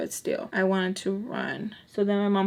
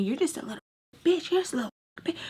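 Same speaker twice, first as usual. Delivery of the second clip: mocking taunt; 8 semitones higher, much faster than usual. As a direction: imitate a sneering caller in a higher voice. Mother's friend insulting her by phone.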